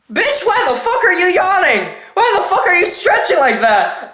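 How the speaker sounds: laughing mock outrage; incredulous outrage. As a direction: act out being stunned and outraged. His retorts to girlfriend retold.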